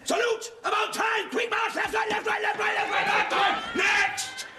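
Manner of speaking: high barked parade commands; drill shout. Acted. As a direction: bark it across the parade ground. Sergeant major drilling marchers.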